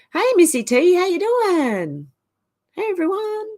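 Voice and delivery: greeting a viewer in a high sing-song; excited greeting. Real, not acted. Greeting chat viewer brightly.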